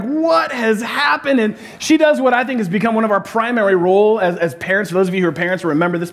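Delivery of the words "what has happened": higher, slow, stretched out question; mock bewildered mother. Pastor imitates his wife walking in on the fight, slow and high. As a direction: drag it out like a baffled mom. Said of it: "Jeannie comes in like".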